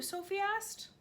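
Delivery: ordinary delivery, nothing marked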